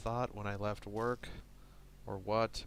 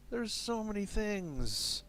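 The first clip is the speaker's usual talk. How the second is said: high overwhelmed squeal; 12 semitones higher, slower than usual. Real, not acted. Overwhelmed, repeats much higher.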